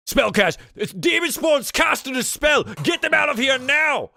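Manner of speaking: high, loud acted cry of alarm; alarmed shouting. Froon spotting a patron casting a spell, alarmed shout. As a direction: yell the warning across the bar.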